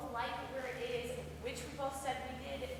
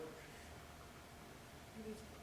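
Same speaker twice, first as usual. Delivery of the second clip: whispering; 4 semitones lower, 18 dB quieter, much slower than usual. Acted. Hesitant question.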